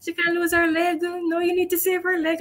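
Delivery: high, pleading voice of a grandmother in tears; imitating crying pleas. Grandmother begging the doctor to save her leg, high and tearful. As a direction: beg the doctor through mock tears. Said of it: "hugged me up and started crying".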